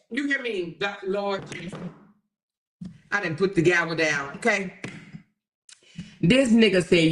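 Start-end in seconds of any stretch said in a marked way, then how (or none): none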